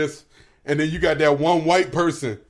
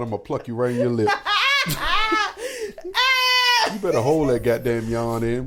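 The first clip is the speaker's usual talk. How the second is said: laughing threat; faster than usual. Real, not acted. Teasing threat to cohost.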